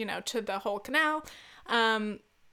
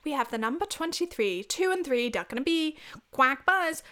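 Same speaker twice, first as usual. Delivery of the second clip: lilting British bingo-caller voice; singsong the rhyme in mock British; 3 semitones higher than usual. Bingo caller calling twenty-three, mock British accent. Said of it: "So, like, for example, twenty-three again".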